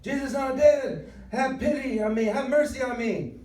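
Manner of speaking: high, loud cry for mercy; desperate scream. Acted. Blind Bartimaeus calls to passing Jesus, screaming desperately. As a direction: scream it out over a crowd to be heard. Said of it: "jumps up and starts screaming".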